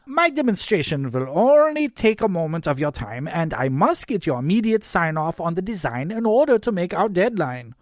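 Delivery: put-on high, loud, insistent German accent; thick German accent. A narrator's reading. Yule demands sign-off before the deadline, thick German accent. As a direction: press the point in heavy German English. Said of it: "in a thick German accent".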